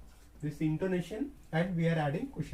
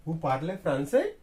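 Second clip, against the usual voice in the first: "français" louder, jumping up at the end; rising question tone; 6 dB louder than usual. Shown as a demonstration. French teacher showing intonation questions, pitch raised at the end. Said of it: "at the ending we have to raise our tone".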